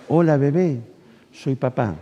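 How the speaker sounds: soft, tender voice close to the ear; hushed daddy-to-baby hello. Retold or imitated. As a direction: greet a newborn gently, almost murmured. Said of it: "me acerco al oído".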